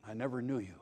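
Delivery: very soft, low pronouncement; quiet, solemn dismissal; grave hushed rejection. Acted. Voices the Lord rejecting religionists.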